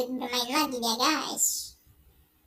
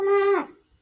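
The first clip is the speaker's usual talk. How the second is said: higher, louder anguished call; desperate cry; 5 semitones higher, 5 dB louder than usual. Acted. Wife calling out desperately to her husband, louder anguished cry. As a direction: call out to him in despair.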